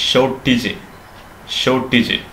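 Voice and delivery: ഷോർട്ടേജ് as "SHO-tij", silent r; no r, short "-ij" on ഷോർട്ടേജ്; correct pronunciation. Shown as a demonstration. Teacher modelling for learners, ഷോർട്ടേജ് as "SHO-tij". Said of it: "ആറ് silent ആണ്".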